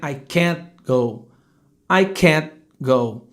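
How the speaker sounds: slow "can't", a held stop before "go"; blocked final t. Shown as a demonstration. Teacher in example sentence, "can't" with unreleased t. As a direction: halt on the t of "can't", then "go". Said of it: "Repare que o ar não passa".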